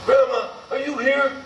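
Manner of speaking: high, hesitant call into the room; a grandson calling gently for his grandma. Retold or imitated.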